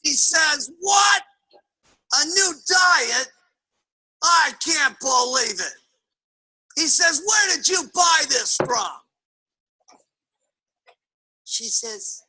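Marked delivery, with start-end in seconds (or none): 0.8-5.7 high, slow, drawn-out disbelief; hammed-up scandalised bellow; outraged husband. Negative husband hears about wife's new diet, outraged. 7.2-9.0 higher, slow demanding question; hammed-up indignant grilling; outraged husband. Negative husband interrogates wife about her purchase, outraged.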